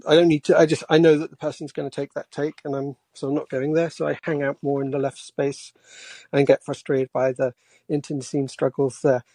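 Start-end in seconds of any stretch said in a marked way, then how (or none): none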